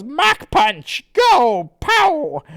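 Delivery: high, loud cartoon attack cry; Blatchu. Blatchu launches a punch, shouting theatrically. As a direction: yell the move like a fighting cartoon. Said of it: "And then Blatchu goes".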